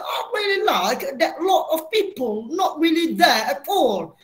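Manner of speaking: slow, hammed-up posh British accent; mock British accent. Teacher mocking a British accent to the class, slow and hammed-up. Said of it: "Tak bunyi macam".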